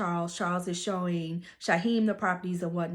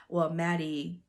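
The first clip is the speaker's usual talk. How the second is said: ordinary delivery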